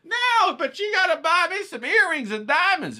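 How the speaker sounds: high, loud put-on woman's voice, whiny demand; mock the girlfriend asking for diamonds, high and loud. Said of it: "The bitch is like".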